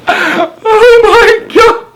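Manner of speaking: very high, slow squeal of laughter; losing it completely; laughing cry. Real, not acted. Cracking up after the poem.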